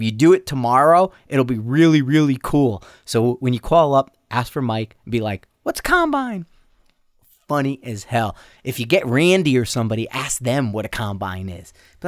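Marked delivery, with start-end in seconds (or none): 5.6-6.5 squeaky high put-on voice asking it; high mock voice. Prank caller to Mile High staff, squeaky high voice. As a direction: pitch it way up like a goofy prank caller.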